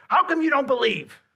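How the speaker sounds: high, loud, hammed-up scolding; a joking Jesus scolding his disciples, overdone; mock scolding. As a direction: wag the bony finger at them. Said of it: "he gives him the bony finger".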